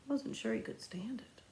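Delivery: whispered despair. Acted. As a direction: breathe it out like a hopeless thought. Despairing inner thought.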